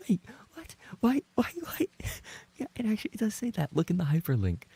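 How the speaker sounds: high, incredulous squeak; high disbelief. Real, not acted. Streamer reads a strange clip title, high disbelief. Shocked at chat's clip name.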